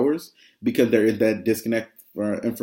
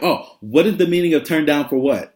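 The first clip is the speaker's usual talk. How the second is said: higher, louder eager students imitated; excited kids; 6 semitones higher, 6 dB louder than usual. Students asking their teacher what it's about, imitated eager kids. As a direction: imitate kids blurting out a question.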